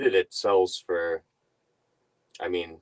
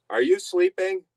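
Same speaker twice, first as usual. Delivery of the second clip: high, louder imitation of the brother-in-law asking; mock urgent text; 12 semitones higher, 6 dB louder than usual. Reading her brother-in-law's texts to viewers, high urgent imitation. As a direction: voice his impatient text with a jump up in pitch.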